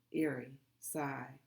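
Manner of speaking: plain reading voice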